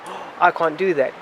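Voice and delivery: normal talking voice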